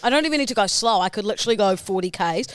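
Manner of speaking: ordinary talk, nothing marked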